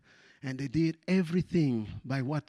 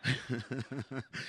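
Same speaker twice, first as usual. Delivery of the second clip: laugh; 5 semitones lower, 11 dB quieter than usual. Real, not acted. Laughs at own English.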